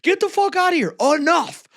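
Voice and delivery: screaming at him to stop; high shouting "enough". Retold or imitated. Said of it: "they're like".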